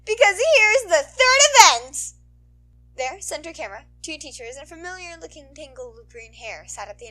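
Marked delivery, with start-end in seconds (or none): 0.0-2.1 hyped announcer. Acted. Announcer hyping the crowd, loud and high. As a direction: pump up the whole crowd.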